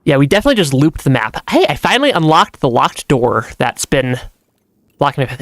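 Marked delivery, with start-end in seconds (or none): none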